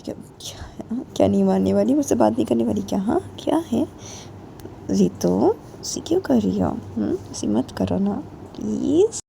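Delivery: quiet, coaxing plea; soft pleading. Acted. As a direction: plead gently, keeping it soft. Pleading with angry friend. (धीमी आवाज़ में मिन्नत)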